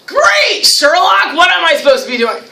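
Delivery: high, loud sarcastic "Great" easing down; sarcastic comeback. Acted. Pupil retorts to an unhelpful teacher, sarcastic. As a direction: throw it back with mock exasperation.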